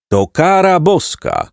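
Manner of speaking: high, slow, drawn-out cry; high-pitched solemn cry. A narrator's reading. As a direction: call down a slow pronouncement from above. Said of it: "krzyknął ktoś ponad nimi".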